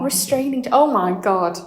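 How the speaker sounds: ordinary talk, nothing marked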